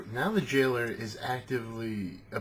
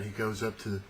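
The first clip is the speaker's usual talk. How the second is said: ordinary delivery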